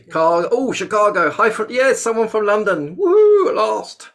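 loud, high-pitched cheering welcome; excited greeting. Real, not acted. Host spotting a fellow Londoner at last, excited cheer. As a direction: whoop with delight as you call out arrivals.

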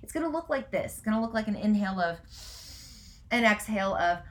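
ordinary delivery

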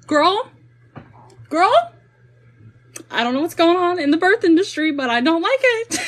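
high, loud, incredulous exclaiming; appalled disbelief. Retold or imitated. Birth coach reliving her shock at a video, appalled. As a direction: cry out in playful outrage, pitch way up.

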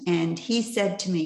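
usual speaking voice

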